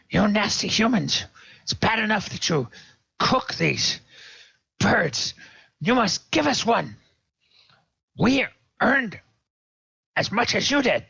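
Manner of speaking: high-pitched kobold creature voice; a small creature's demanding squawk, far above normal pitch. Acted. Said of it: "and it's saying".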